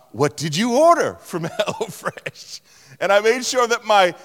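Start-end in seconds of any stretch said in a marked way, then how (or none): 0.1-2.6 overly sweet, careful question; carefully pleasant, laughing a little; as nicely as possible. Retold or imitated. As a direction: say it as nicely as you can to dodge a fight. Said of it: "I said as nicely as I could".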